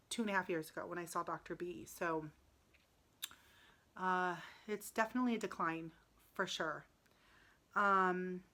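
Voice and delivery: normal talking voice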